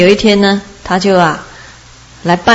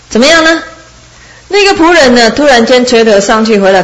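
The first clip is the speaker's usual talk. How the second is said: normal talking voice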